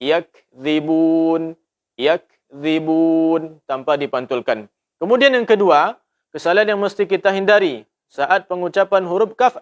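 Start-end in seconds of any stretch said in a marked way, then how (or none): 0.0-3.6 slow yakzibun, kaf not bounced; correct yakzibun. Shown as a demonstration. Teacher reciting yakzibun the right way. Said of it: "Cara pengucapan yang benar adalah".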